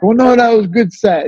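mock vendor refusing a refund to a soldier; imitating local vendor. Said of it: "they're like".

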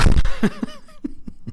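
high burst of real laughter; sudden high laugh at his own remark; embarrassed laugh. Real, not acted. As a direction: laugh it off, caught oversharing.

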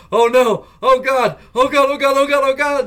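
imitating a friend panicking as a spider charges; panicked. Friend panicking at a giant spider, high frantic cries. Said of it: "I hear Sub go".

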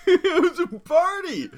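high, loud, slow, laughing. Real, not acted. Laughing reply.

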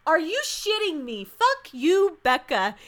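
real outrage at the TV; fuming at her; outraged. Real, not acted. Outraged at Becca's line.

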